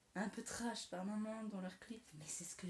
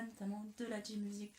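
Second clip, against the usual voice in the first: normal talking voice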